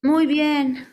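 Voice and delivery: loud, high-pitched approval; enthusiastic praise. Real, not acted. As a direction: praise the student with real energy. Praises a student's correct answer.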